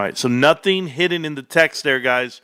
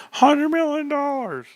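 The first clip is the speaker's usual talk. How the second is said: excited; 12 semitones higher than usual. Real, not acted. Gleeful repetition.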